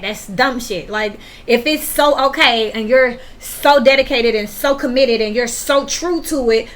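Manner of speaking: ordinary talk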